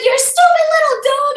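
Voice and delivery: high, loud mimicked insult; retold screaming. Neighbour insults the little dog, screaming high. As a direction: shriek it like the angry neighbour. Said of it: "she starts screaming at me".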